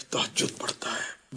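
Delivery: soft slow whisper. Retold or imitated. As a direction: breathe it out in a whisper. People whispering praise about someone.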